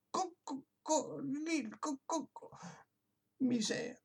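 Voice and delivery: high, soft broken crowing attempts; failed crow. A narrator's reading. Voiceless rooster trying to crow, high, soft and breaking. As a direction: choke out the crow, voice failing. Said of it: "gémit Coquelicot".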